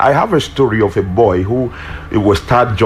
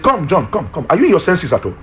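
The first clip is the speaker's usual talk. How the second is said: baffled teacher; 8 semitones higher than usual. Retold or imitated. Teacher baffled by laughing boy.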